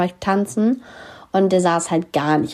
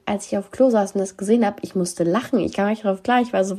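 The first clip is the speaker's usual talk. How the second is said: normal talking voice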